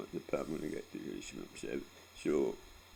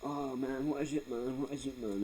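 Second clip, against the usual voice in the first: mocking dad; 9 semitones higher than usual.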